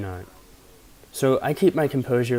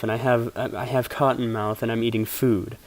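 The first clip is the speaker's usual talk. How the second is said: ordinary talk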